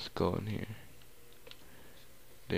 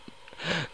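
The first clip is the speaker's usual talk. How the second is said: gasp; 10 semitones higher, 5 dB quieter than usual. Real, not acted. Mob attacks in the dark.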